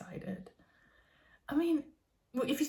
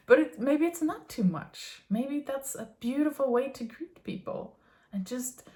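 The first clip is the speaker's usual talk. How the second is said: usual speaking voice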